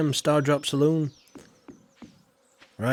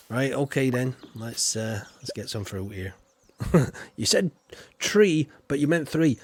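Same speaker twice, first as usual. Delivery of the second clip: ordinary talk, nothing marked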